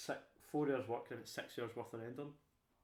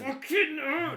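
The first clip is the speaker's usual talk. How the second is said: furious yell, much higher and louder; angry shout; 16 dB louder, much slower than usual. Retold or imitated. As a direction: explode in rage. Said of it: "so I was so angry".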